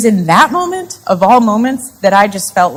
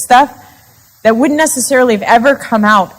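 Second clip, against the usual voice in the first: usual speaking voice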